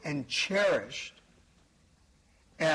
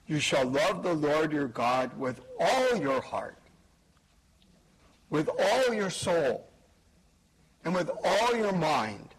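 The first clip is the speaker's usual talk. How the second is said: "all" pressed hard and pitched up, the rest of each phrase plain; stress on ALL. Shown as a demonstration. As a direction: hit "all" in every phrase, rising on it. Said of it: "Now, I want to emphasize a word here".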